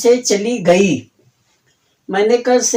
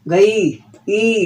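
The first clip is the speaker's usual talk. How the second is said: drawn-out ending; much slower than usual. Shown as a demonstration. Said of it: "जिस वाक्य के अंत में गया".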